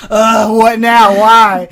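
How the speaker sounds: loud, drawn-out fed-up groan of words; mock exasperation. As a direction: act the weary friend, loud and slow. Friend answering his needy call.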